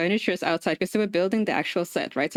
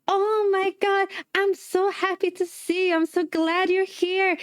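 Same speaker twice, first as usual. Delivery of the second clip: overjoyed lady gushing over a guest; gushing greeting; 10 semitones higher than usual. Retold or imitated. Korean lady gushing at her.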